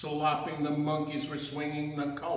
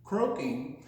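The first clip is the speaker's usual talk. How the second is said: ordinary talk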